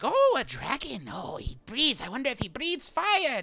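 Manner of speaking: high, swooping wonder; excited little creature. Acted. Small Alliamites looking around at a dragon, high excited wonder. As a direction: marvel aloud in a high-pitched small voice. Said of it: "They, uh, shouting out".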